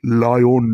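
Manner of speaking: "Lion" pitched low, long and weighty; grave, low "lion"; very deep. Shown as a demonstration. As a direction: intone "lion" like the king of the jungle. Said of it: "অত্যন্ত গভীরভাবে বলতে হবে".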